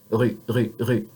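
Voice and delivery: "ra" with a throaty French "r", mouth open. Shown as a demonstration. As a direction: uvular "ra", tongue tip hidden. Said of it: "one more time".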